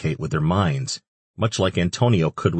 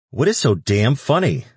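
sharp, higher, louder irritated question; annoyed demand; 6 semitones higher, 4 dB louder than usual. Acted. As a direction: demand an answer, fed up. Antonio irritated by laughing friends.

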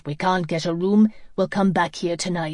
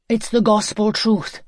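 louder, heated insistence; furious; 3 semitones higher, 6 dB louder than usual. A narrator's reading. Nick swears to Lizzie it is true, furious. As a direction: insist on being believed, angrily. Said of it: "Nick said furiously".

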